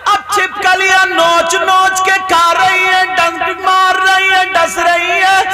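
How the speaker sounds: high, loud preacher's cry, drawn out; fiery preaching. Acted. Preacher warning the girls about disobedience, full force.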